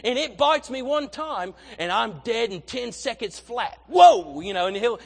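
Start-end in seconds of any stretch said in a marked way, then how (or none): 0.1-3.8 put-on light Australian accent as Steve Irwin, a bit softer; a playful Aussie Crocodile Hunter take; mild Australian accent as an excited TV naturalist; Steve Irwin impression. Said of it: "He's like". 3.9-4.4 high, loud Australian whoa; a loud Australian whoa of thrill; excited Steve Irwin whoop. Retold or imitated. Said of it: "He's like".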